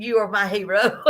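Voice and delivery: amused praise breaking into laughter; laughing gratitude. Real, not acted. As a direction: say it into a big laugh. Laughing at viewer's offer to help.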